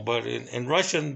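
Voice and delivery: ordinary delivery, nothing marked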